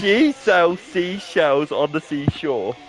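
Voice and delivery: slow, evenly spaced words; measured, word-by-word demonstration; slow careful tongue twister. Shown as a demonstration. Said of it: "Now say it really quick".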